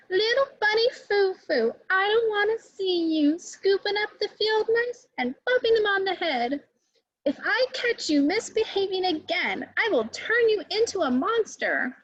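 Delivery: high, stern fairy warning the bunny. Acted. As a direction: scold like a strict good fairy. Good fairy warns bunny Foo Foo.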